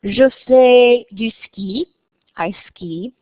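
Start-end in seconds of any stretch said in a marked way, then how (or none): 0.0-1.9 Je fais du ski said slowly and clearly; careful French sentence; French example. Shown as a demonstration. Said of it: "So I could say".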